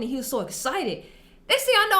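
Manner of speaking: normal talking voice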